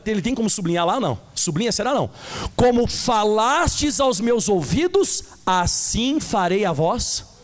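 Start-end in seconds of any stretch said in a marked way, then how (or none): 2.5-7.3 slow solemn reading. Acted. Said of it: "De novo".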